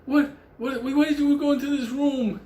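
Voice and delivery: high, incredulous questioning; baffled vet. Retold or imitated. Vet questioning Benny about the wrong room, high incredulous voice. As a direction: squeak out the disbelief. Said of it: "And he's like".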